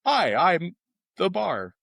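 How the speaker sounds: robot bar voice. Pretend. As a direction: play a cheerful talking wall. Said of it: "some A I program on the wall being like".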